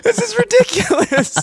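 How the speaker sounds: very high strained squeal; hammed-up choked disbelief; mock tear-gassed distress. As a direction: squeal it as if choking. Retold reaction while tear gassed.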